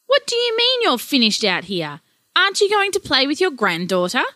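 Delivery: mildly cross wife scolding a lazy husband; rather angry. Acted. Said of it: "said Sandra rather angrily".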